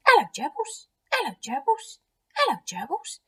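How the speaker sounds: put-on high, squeaky little child voice; two-year-old boy. Eddie greeting gerbils in their cage, toddler voice. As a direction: chirp it like a toddler greeting pets. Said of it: "he was two years old".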